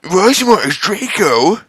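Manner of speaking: high shouted announcement; high-pitched shout. Acted. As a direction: blurt it out in alarm. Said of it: "we shouted at the same time".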